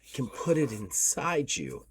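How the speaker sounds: put-on soft, lightly French-accented woman's voice; a touch of French, spoken softly. Mysterious woman handing the player a wrench, light French accent. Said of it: "beautiful woman's voice with a French accent".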